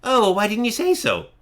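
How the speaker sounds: put-on high-pitched little old hermit voice; suddenly changed voice. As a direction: drop the booming act, go high and friendly. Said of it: "And then immediately changes to".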